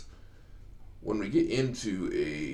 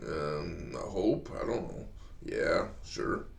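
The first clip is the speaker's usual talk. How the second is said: put-on unenthusiastic teen; 4 semitones lower, 3 dB quieter, slower than usual. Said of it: "And they're like".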